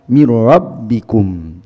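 mir rabbikum with the doubled rr and bb pressed hard before the falling kum; stress before b. Shown as a demonstration. Teacher isolating the stressed phrase for students, pressed. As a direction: lean on the rr-bb in mir rabbikum then let kum drop. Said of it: "sebelum B-nya itu mir rabbikum, ada penekanan".

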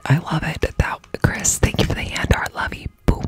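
whispering. Real, not acted. Whispered thanks.

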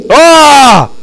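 sudden high yelp of fright; startled scream. Real, not acted. Streamer jumps at a scare in the game, yelling out.

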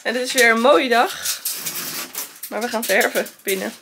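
upbeat vlogger greeting; cheerful to-camera presenter voice; vlog intro. Acted.

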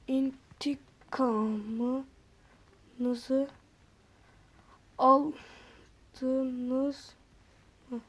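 çok yavaş ve alçak sesle "İntikamınızı aldınız mı?"; "İntikamınızı" kelimesini uzatarak, kısık ve yavaş sor. Shown as a demonstration. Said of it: "Böyle dedim". (kısık, ağır ağır soru)

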